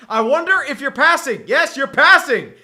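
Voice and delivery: laughing sarcastic call; sarcastic glee. Real, not acted. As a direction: gloat that he called it. Sarcastic call on obvious pass.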